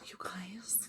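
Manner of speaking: hushed, breathy whisper to the listeners; barely-there whisper; whispered aside. Real, not acted. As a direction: whisper it very softly, almost no voice. Repeats it softly to listeners.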